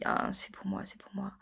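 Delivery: hushed, to herself; whispered quote. Retold or imitated. Recalling seeing the patterns, a hushed whispered aside. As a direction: murmur it like a secret. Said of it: "j'ai dit".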